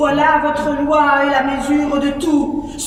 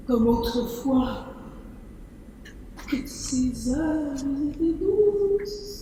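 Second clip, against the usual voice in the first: soft, low, intimate; hushed counsel; 3 semitones lower, 11 dB quieter than usual. Acted. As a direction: murmur the advice in the night. Conseil nocturne, doux. (conseil murmuré la nuit)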